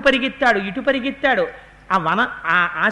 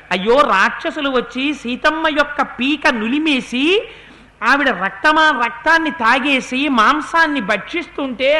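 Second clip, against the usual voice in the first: ordinary talk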